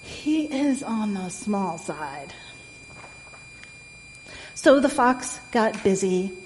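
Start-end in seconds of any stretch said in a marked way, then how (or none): 0.0-2.3 slow, softer fox pondering; musing to himself. A narrator's reading. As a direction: think it over slowly and quietly. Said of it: "the fox said to himself".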